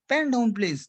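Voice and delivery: normal talking voice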